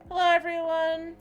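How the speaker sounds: high, loud hello to the room; excited greeting. Real, not acted. Streamer welcoming viewers into the stream, excited.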